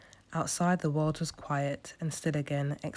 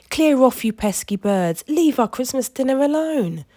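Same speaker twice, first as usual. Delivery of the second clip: loud, high angry yell; shouting at gulls; 10 semitones higher, 11 dB louder than usual. A narrator's reading. George yelling at seagulls stealing dinner, loud shout. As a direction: shoo the birds away with all your voice.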